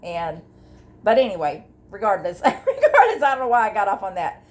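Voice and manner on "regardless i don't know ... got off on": high burst of laughter on the word; laughing. Real, not acted. Laughing at her own tangent while telling viewers. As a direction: laugh it out at herself.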